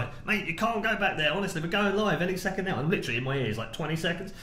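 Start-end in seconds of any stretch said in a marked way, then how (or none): 0.3-2.8 higher, urgent retold shout. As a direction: urgently yell at the intruder, higher. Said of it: "I'm shouting at him".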